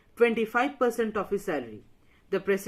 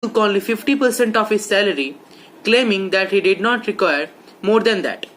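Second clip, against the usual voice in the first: reads the fact out much louder; louder retake; 9 dB louder than usual. Real, not acted. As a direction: project it strongly, like a re-recording.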